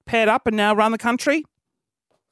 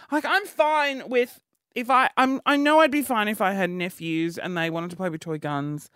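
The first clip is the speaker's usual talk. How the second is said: usual speaking voice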